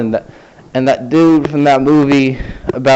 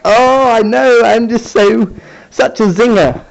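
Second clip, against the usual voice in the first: high mock-proud gloat, much higher; sarcastic self-praise; 10 semitones higher, 4 dB louder than usual. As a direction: pat yourself on the back, ironically. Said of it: "sarcastic self-praise".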